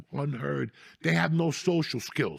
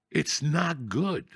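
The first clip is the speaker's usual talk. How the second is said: slowly, heavy and dismayed; slow, sad; much slower than usual. Real, not acted. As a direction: drag it out in dismay. Slow repeated lament.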